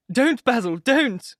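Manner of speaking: high, loud desperate cry. A narrator's reading. Dorian to Basil, stopping him ripping the painting, crying out. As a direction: stop his hand before he cuts the canvas. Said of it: "he cried".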